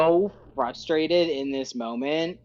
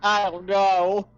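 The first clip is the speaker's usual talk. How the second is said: slow, lightly whimpering lament; a touch of tearful frustration, drawn out; mock crying; 4 semitones higher, slower than usual. Fletcher on the ground whines to his friends, half crying. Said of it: "kind of crying, kind of angry".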